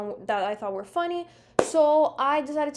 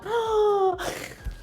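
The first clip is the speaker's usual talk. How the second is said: big fake shocked gasp; high, hammed-up gasp; exaggerated gasp; 9 semitones higher than usual. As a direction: gasp hugely, overdone for show. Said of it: "make my thumbnails a bit more exaggerated".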